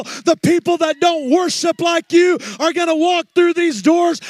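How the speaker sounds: high, loud, building sermon cry; preaching at full force. Acted. Pastor preaches to his church about the teens, building to a loud cry. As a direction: drive it home to the congregation.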